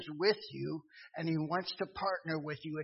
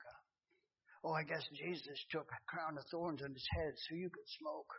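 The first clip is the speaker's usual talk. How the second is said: soft, hushed voice of the Spirit; still small voice; 2 semitones lower, 13 dB quieter than usual. Retold or imitated. The Spirit of God speaking softly to the sinner. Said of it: "in that still small voice".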